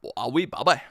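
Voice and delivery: playful Northern Irish or Scottish lilt; mock-thick Northern Irish brogue; put-on regional accent. Shown as a demonstration. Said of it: "I think it's my fat bastard accent".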